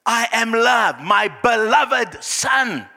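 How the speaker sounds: loud, high, slow triumphant shout; rousing pulpit exultation; exultant declaration. Acted. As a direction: proclaim being loved, stretching the last words. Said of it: "What did Jesus hear?".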